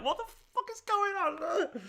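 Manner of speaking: very high, slow, squeaky stunned voice; mock gobsmacked squeal; stunned disbelief. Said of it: "And I was like".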